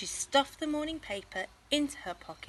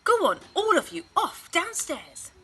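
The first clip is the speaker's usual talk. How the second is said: loud, high shoo, slowing; shooing mum; 10 semitones higher, 10 dB louder than usual. Acted. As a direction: send the kids off firmly. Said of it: "said Missus Large".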